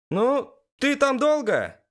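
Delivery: very high, slow female character voice; Elis, indignant. A narrator's reading. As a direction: call out impatiently in a high, put-out voice. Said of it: "послышалось возмущение Элис". (возмущённо, высоким голосом)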